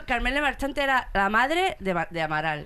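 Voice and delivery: normal talking voice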